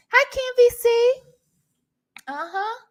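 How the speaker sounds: normal talking voice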